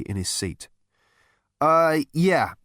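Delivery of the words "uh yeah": ordinary delivery, nothing marked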